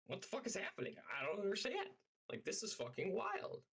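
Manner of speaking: high, stunned disbelief; incredulous. Retold or imitated. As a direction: squeak out shocked confusion. Retold disbelief at texts.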